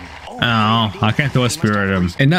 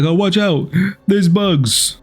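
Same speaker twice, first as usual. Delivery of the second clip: mock alarmed AI; 7 semitones higher, slower than usual. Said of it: "be like, like".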